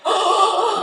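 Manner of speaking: high mock gasp of horror; retold shock. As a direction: gasp a high "oh", acting appalled. Retelling shock at the sign.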